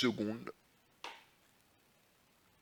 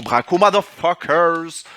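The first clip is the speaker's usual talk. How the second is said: mock swagger; 5 semitones higher, 9 dB louder, slower than usual. Annonce le braquage en bravade.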